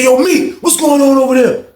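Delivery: high loud call; calling to the neighbor. Retold or imitated. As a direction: call through the wall. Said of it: "He said".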